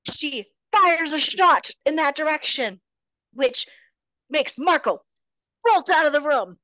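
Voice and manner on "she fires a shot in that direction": usual speaking voice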